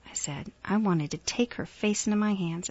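plain reading voice

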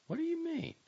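high-pitched question without added volume; quiet question. A narrator's reading. Startled girl questioning the narrator's hint, quiet. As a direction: ask it high, without pushing. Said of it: "she asked quietly".

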